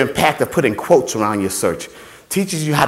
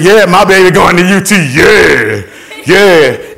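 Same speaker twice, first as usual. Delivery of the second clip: boasting dad; 6 dB louder, slower than usual. Acted. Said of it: "And he said".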